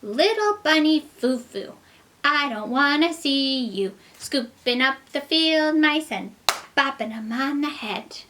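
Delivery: high, loud fairy scolding. Acted. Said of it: "and she said".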